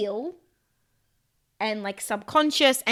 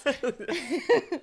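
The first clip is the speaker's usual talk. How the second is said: laugh; 4 semitones higher than usual. Real, not acted.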